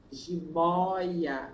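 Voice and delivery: "Jiboia" with an open O, like "jibóia"; low, drawn-out "Jiboia" showing the open O; open vowel. Shown as a demonstration. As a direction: stretch the open "ói" inside "Jiboia".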